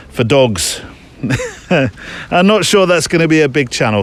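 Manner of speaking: ordinary delivery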